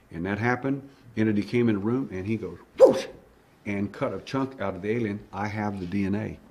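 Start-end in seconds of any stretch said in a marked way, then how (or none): 2.8-3.1 short loud whooshing noise; sudden vocal swish; sword whoosh. Retold or imitated. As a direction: imitate a blade slicing through air. Imitating the sword's swing.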